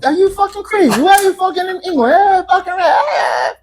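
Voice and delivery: very high, hammed-up English disbelief; imitated English accent. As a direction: squeal it in a mock English accent. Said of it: "they always say".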